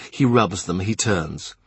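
usual speaking voice

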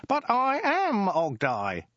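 very high, drawn-out outrage; high-pitched, wounded disbelief; indignant protest. Acted. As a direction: protest slowly at top pitch. Ogdai outraged at being forgotten.

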